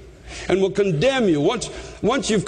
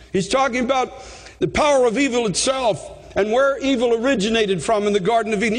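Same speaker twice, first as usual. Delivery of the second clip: normal talking voice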